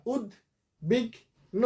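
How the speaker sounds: উদ্বিগ্ন drawn out as ud, bik, no; syllable by syllable. Shown as a demonstration. Teacher counting three syllables, slow and separated. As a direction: pause between the three beats of উদ্বিগ্ন. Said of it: "তিনটা".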